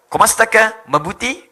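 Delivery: imitating a Tagalog greeting; Filipino accent. Shown as a demonstration. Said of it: "kayak orang Filipin". (menirukan logat orang Filipina)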